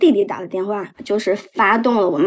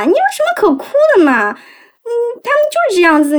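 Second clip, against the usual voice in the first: higher, fast, dismissive mother's voice; mother brushing it off; 10 semitones higher, much faster than usual. Retold or imitated. Mother to crying daughter, higher and quick. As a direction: toss it off lightly like mom.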